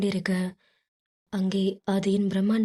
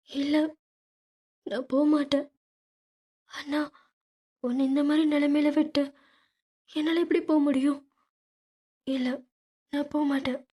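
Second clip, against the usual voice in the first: tearful refusal; 6 semitones higher than usual. Acted. Sister refusing to leave, crying.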